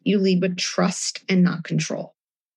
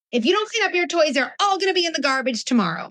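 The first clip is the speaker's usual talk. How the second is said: higher, stern mock threat; threatening parent; 9 semitones higher than usual. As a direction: play the fed-up parent laying down a threat. Acted angry parent threat.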